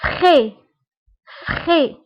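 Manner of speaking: فخي said as French frais, slow and clear; teacher modelling فخي as French frais; French word model. Shown as a demonstration. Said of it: "إكزامبل".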